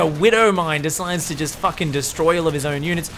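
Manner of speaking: ordinary talk, nothing marked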